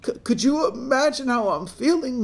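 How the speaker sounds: high-pitched acted anguish; distraught. Crying daughter to her mother, distraught. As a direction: wail it at your listener. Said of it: "fifteen-year-old crying".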